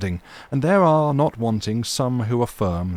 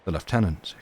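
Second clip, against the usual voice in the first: ordinary delivery, nothing marked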